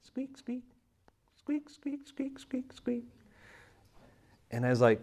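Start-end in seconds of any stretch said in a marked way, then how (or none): 0.0-3.0 high, thin squeaky-hinge noises, softly; doorknob squeak imitation. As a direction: mimic a creaking doorknob, high and soft. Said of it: "our doorknob squeaking".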